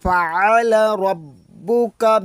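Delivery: slow, lifted, chanted verse; Quran recitation. Shown as a demonstration. Teacher recites the first verse for students. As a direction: recite slowly with held endings.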